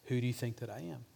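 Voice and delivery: soft, quick, intimate question; gentle nudge. Acted. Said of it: "when you feel him nudging you".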